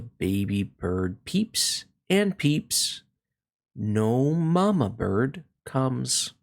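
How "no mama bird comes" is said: ordinary delivery, nothing marked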